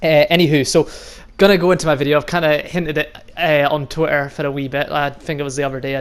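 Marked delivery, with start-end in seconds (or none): none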